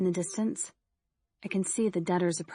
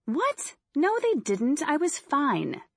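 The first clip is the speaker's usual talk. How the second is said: incredulous denial; 9 semitones higher, slower than usual. Acted. Nina denies being in danger.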